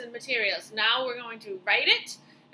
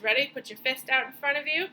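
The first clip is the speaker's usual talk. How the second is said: normal talking voice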